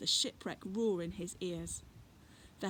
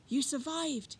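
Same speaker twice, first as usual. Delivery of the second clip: higher, wondering voice; amazed relief; 8 semitones higher, 3 dB louder than usual. Acted. Fred relieved to find the children alive, wondering. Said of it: "he said".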